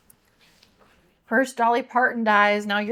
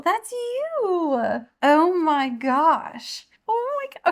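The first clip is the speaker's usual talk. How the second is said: high, drawn-out amazement; overwhelmed excitement; 5 semitones higher, much slower than usual. Real, not acted. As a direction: gush in disbelief, pitch climbing high. Seeing husband's name on cover.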